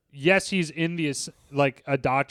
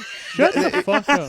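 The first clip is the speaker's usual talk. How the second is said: higher and slow, laughing through it; laughing protest; 12 semitones higher, 4 dB louder, slower than usual. Real, not acted. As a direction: laughingly tell him to stop. Friend teases about his lies.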